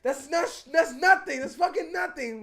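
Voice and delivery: high, loud, cocky dismissal; imitating dad shouting it off after eating an ant; mock bragging shout. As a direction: belt it out like nothing fazes him.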